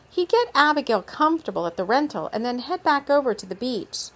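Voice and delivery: plain reading voice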